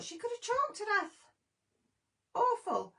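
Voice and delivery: high, shaken voice. Real, not acted. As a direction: still frightened by it. Retold fright.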